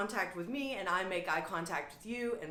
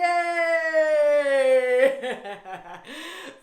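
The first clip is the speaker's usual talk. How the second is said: joyful laugh; 7 semitones higher, 12 dB louder than usual. Real, not acted. Laughter exercise cheer.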